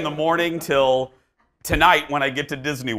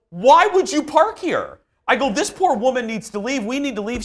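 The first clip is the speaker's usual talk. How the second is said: mock outrage; 9 semitones higher than usual. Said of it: "And I go".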